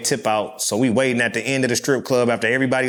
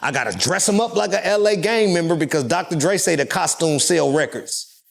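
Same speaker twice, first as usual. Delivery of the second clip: normal talking voice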